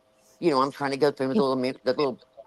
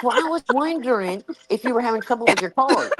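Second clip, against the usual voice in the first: imitating Jackie; 9 semitones higher, 3 dB louder than usual. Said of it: "She goes".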